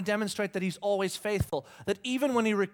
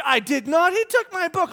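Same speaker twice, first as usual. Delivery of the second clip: put-on high, loud, jumbled child shrieks; imitated squabbling kids screaming; 9 semitones higher, 9 dB louder than usual. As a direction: yell over each other like children fighting. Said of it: "this is what she's screaming".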